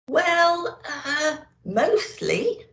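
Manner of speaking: high, hesitant little elf voice; a hesitant old elf, high and unsure. A narrator's reading. Elf hedging to Santa, high and hesitant. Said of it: "answered the little old elf".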